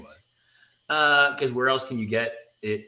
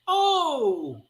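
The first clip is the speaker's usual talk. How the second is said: startled gasp. Acted. Kitty startled by Jean.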